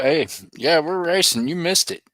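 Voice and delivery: usual speaking voice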